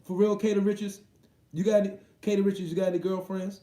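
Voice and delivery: playful call-out. Real, not acted. Calling out to a fan.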